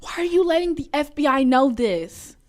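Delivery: high, loud scolding; mock outrage. Real, not acted. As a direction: exclaim it in exasperated disbelief. Mock outrage at name leak.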